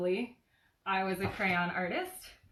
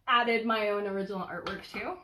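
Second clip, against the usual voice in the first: usual speaking voice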